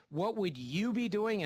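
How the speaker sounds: slow question leaning hard on "you"; stress on YOU. Shown as a demonstration. Teacher quizzing students on classroom focus, stress on "you". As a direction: draw out and emphasize "you". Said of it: "I emphasize you".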